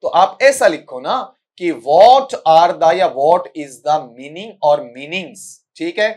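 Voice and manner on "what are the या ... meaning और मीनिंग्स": slow, deliberate model question; slow dictation. Shown as a demonstration. Teacher dictating the right way to phrase the question. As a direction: spell out the correct wording slowly. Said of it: "ऐसा आना चाहिए आपका".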